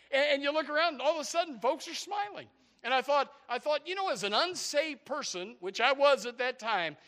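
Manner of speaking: impassioned testimony to the congregation; excited preaching. Real, not acted. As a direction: tell it with mounting fervor.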